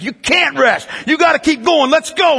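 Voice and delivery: high, forceful command; urgent coach yelling. Retold or imitated. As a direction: drive a flagging player on. Coach refuses to let player rest.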